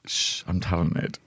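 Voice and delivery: soft, slow, smug little hush; hushed mock boast. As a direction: hush them, then brag quietly.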